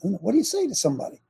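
ordinary talk, nothing marked

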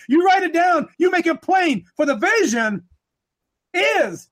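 high-pitched, driving sermon cadence; impassioned preaching. Real, not acted. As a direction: build the charge name by name, urgently. Preaches at full force.

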